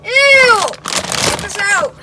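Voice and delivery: loud, high yell of disgust; disgusted shout. Acted. Trapped in a fridge, recoiling from food, disgusted shout. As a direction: shout it out in revulsion.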